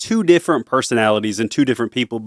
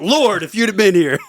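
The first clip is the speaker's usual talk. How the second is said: accusing "Lord" with a laugh; 7 semitones higher than usual. Retold or imitated. Martha scolding Jesus: "Lord, if you'd have been here." in laughing mock reproach. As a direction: punch "Lord" like a complaint. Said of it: "Not, not".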